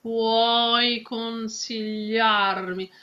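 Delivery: slow, every consonant in "consigliarmi" given space; living consonants. Shown as a demonstration. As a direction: give each consonant length and pitch. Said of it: "give a dignity, a place and the space".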